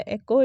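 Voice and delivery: एको with e like bed; correct echo. Shown as a demonstration. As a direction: say एको, not इको. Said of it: "correct pronunciation". (सही उच्चारण: एको)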